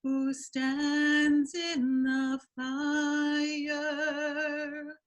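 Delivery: very slow, high, breathy chant of "stands"; drawn-out breathy chant. Acted. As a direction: stretch "who stands in the fire" on long breaths. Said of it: "Here's my breathy voice".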